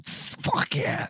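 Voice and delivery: breathy whisper, slow. Real, not acted. Host whispers a reply to a cohost's pun, hushed whisper. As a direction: whisper it under the mic, slowly.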